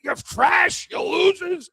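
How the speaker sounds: mock rant. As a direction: yell it at the trolls in mock rage. Ranting at online critics.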